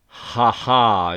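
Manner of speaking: a loud, hearty burst of laughter; glad laugh. A narrator's reading. Kråkrune bursting out at the lit ships, loud laugh. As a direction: laugh out delighted. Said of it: "utbrister Kråkrune glatt".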